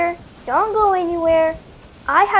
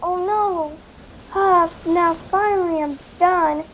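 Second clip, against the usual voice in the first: ordinary talk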